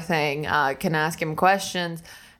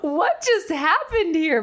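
high, slow, laughing what-just-happened; laughing stunned passenger, high and slow; laughing disbelief; 10 semitones higher, slower than usual. Retold or imitated. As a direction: let the laugh carry the disbelief. Laughing disbelief after the lap.

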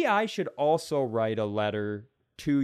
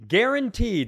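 loud, higher-pitched sales promise; hammed-up guarantee; salesman's guarantee; 5 semitones higher, 5 dB louder than usual. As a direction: punch it out like a pitchman. Mock salesman making a promise.

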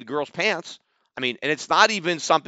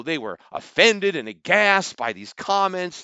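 usual speaking voice

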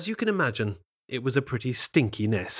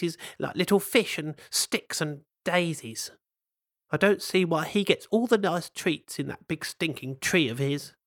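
a hint of a fed-up otter, higher; lightly voiced otter; 5 semitones higher than usual. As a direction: complain like a grumbling cousin. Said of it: "said the otter".

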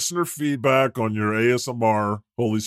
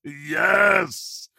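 excited yes; 5 semitones higher than usual. Real, not acted. Cheering a weed joke.

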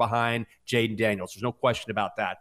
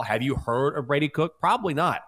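ordinary talk, nothing marked

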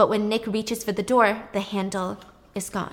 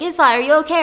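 urgent call; 7 semitones higher, 9 dB louder, much faster than usual. Retold or imitated. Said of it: "And he's like".

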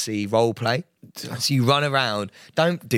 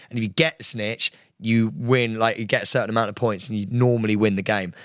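usual speaking voice

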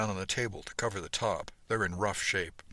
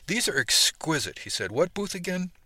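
admiring; 9 semitones higher, 4 dB louder than usual. A narrator's reading. Admiring the buckles eagerly.

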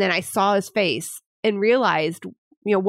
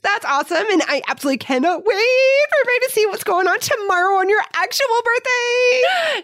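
high, louder giddy build-up; giddy excitement; 4 dB louder than usual. Real, not acted. Cohost teasing the birthday surprise, high giddy gush. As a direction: gush about tomorrow.

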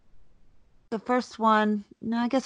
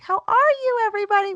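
much higher, bright put-on teacher hello; sing-song fake cheer for the parents; fake cheerful greeting; 14 semitones higher, 4 dB louder, much faster than usual. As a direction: paste on a chirpy welcome.

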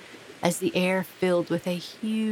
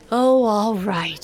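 usual speaking voice

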